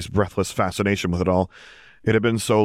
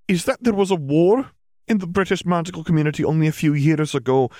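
higher-pitched witch recounting a war; McGonagall, grave explanation; 10 semitones higher than usual. A narrator's reading. Witch telling parents of the war, grave higher voice. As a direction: lay out grave history in a raised, formal voice.

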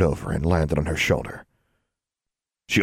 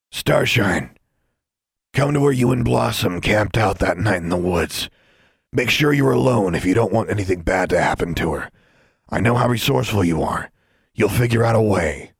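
sinister note voice; 3 semitones higher, 3 dB louder than usual. Acted. Reading the kidnapper's note.

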